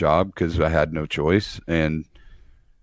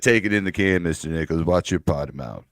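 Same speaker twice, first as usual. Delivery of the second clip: a light imitation of a prim rebuke; mock prim scold; 5 semitones lower, 4 dB quieter than usual. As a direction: lower retold prissy scolding. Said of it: "You said".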